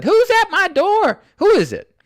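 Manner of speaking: put-on high, loud, wary old woman calling through the door; suspicious lady at the door. Homeowner shouting through the closed door at strangers, high and loud. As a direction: imitate a startled homeowner demanding who's there. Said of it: "the lady behind the door said".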